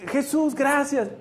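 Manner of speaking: high, drawn-out thanks; euphoric. Acted. Juan thanking Jesus for healing him, euphoric. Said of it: "Pero así, eufórico".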